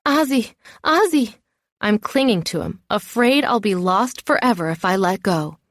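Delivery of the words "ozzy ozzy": breathless calls, higher each time; crying out a name. Acted. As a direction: call out to him in the heat of it. Heroine crying out mid-climax.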